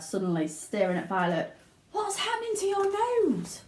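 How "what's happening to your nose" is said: alarmed mother. Acted. Mother stares at Violet's nose, alarmed. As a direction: panic over her daughter. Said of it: "shrieked Missus Beauregard".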